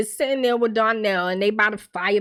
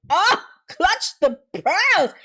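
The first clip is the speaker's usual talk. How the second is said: much higher, slow, scandalized put-on; mock pearl-clutching gasp; 10 semitones higher, 3 dB louder, much slower than usual. Real, not acted. Reacting to Lene's line on the show, slow high scandalized gasp. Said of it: "I was shocked".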